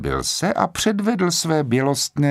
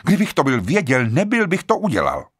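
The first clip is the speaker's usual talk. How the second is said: higher-pitched, pleading protest; crying out in protest; 8 semitones higher than usual. A narrator's reading. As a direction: protest your innocence urgently, without full shouting. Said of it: "zvolal Rogers".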